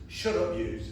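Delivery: loud joke punchline in mock Scouse; put-on Liverpudlian accent. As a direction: imitate a Liverpudlian, raising your voice. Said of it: "people who actually speak Liverpool".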